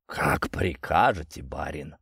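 slow, lazy, sighing reply. Acted. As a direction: sigh it out, unwilling to move. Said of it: "лениво вздохнул Картошка".

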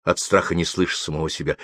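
ordinary delivery

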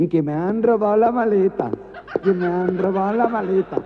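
cantinela aguda entre risas; lamento amanerado. Acted. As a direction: queja cantada y exagerada. Lamento cantarín al guardia. (quejido amanerado al guardia)

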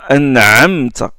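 « an'amta » avec un ayn profond dans la gorge; « an'amta » avec le ayn pharyngal bien sonore; bonne prononciation. Shown as a demonstration. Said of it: "Il faut dire". (« an'amta » en serrant la gorge sur le ayn)

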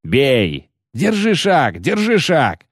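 rising, high-pitched commander's cry; growled battle command. A narrator's reading. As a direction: drive the ranks forward with growled orders. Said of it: "рычал Барклай Эльс".

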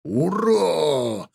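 roared cheer in a strange voice; put-on low roar. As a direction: bellow it in a put-on voice. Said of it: "чужим низким голосом проревел".

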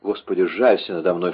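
usual speaking voice

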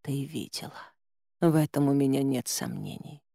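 soft, barely voiced words; almost soundless, drained; barely audible. A narrator's reading. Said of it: "почти беззвучно прошептала женщина".

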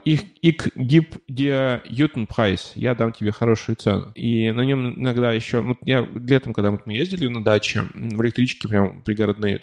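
ordinary delivery

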